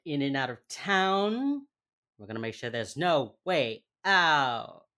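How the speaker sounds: loud, slow hype announcer; booming pumped announcer. Pretend. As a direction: boom it out like an arena. Announcing the episode title.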